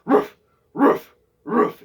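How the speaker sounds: high, loud barking roof; dog bark. Shown as a demonstration. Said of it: "you go like".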